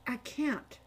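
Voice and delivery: quiet stammered refusal; hesitate, voice dropping away; faltering. A narrator's reading. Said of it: "he said".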